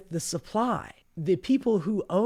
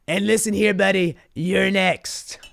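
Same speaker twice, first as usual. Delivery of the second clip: mock Trump; 2 semitones higher, 3 dB louder than usual. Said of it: "he goes".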